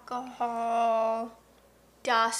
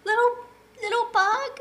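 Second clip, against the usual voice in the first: put-on worried child; 9 semitones higher than usual. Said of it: "And she goes".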